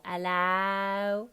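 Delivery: "hello" as a drawn-out ellao; loud HELLO, no h, o widened to ow; ow ending. Shown as a demonstration. Coach refining the actor's vowel, ow ending. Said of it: "more like an ow".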